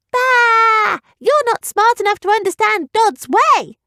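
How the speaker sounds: high silly townsperson voice; crowd heckler. Acted. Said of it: "a person in the crowd added".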